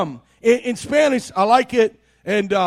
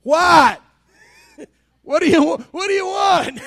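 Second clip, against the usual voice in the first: higher, laughing mock-annoyed reply; grumpy husband; 5 semitones higher than usual. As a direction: play the tired husband who doesn't want to get up. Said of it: "I'm like".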